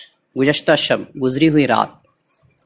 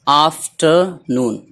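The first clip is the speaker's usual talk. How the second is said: Afternoon slowly, split before noon; word in parts; much slower than usual. Shown as a demonstration. Teacher showing two joined words, afternoon in slow parts. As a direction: break afternoon into after and noon with gaps. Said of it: "दोनों को मिला दिया गया".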